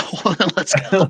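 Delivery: excited. Pretend. Hyped call to go.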